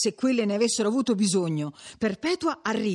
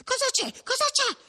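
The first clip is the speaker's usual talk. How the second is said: high, urgent question with a touch of panting. A narrator's reading. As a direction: ask as if just arrived running. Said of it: "domandò Perpetua ansante".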